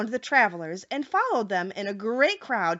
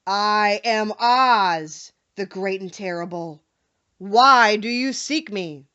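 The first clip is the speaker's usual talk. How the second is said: very slow, louder booming wizard voice; solemn Oz; 6 dB louder than usual. A narrator's reading. Hidden wizard greeting the frightened travelers, solemn. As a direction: boom down from the dome. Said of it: "a solemn voice".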